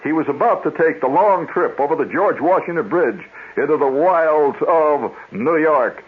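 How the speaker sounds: high, dramatic adventure-serial narration, slowing grandly; radio serial announcer. Retold or imitated. Announcer recaps yesterday's episode for boys and girls, grandly dramatic. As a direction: build it up like a children's radio serial. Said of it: "he would follow it up and he'd say".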